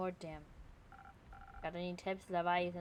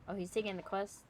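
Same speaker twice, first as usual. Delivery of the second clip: ordinary delivery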